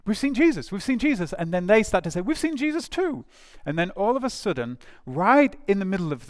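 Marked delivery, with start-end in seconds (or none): 0.1-1.3 high-pitched, excited announcement. Acted. Disciples rushing back to tell everybody else, excited. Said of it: "very excited". 2.2-3.2 very high, eager chiming in; excited. Acted. The others answering with their own sighting, excited. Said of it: "very excited".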